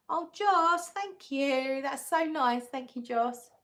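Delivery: high, loud burst of gratitude; touched and excited; delighted thanks. Real, not acted. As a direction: gush with thanks, pitch jumping up then easing. Thanks a viewer for a donation.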